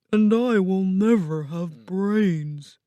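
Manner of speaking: slow, put-on scarecrow voice; Scarecrow lamenting. Scarecrow laments his missing brains, slow character voice.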